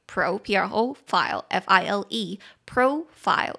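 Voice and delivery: slow spelled-out profile, stressed on PRO; teaching pronunciation. Shown as a demonstration. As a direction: profile broken into PRO and file, then said whole as PROfile. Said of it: "重音节在第一音节".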